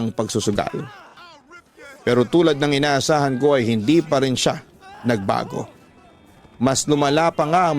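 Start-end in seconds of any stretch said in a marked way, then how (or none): none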